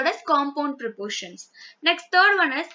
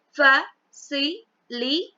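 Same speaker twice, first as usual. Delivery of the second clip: "Facilitate" very slowly, split into four syllables; much slower than usual. Shown as a demonstration. Teacher showing how to syllabify the word, syllable by syllable. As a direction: say "Facilitate" in separate beats with gaps between syllables. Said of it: "syllabify according to the vowels".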